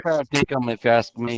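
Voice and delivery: lightly put-on Australian accent; mock Australian accent. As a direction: toss off a jokey Australian accent. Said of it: "name every Australian stereotype".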